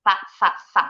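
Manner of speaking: SA as "tha", tongue tip out, repeated in short bursts; th sound. Shown as a demonstration. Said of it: "Ujung lidahnya harus keluar dulu". (SA dengan ujung lidah keluar, bunyi "tsa")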